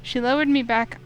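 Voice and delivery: ordinary delivery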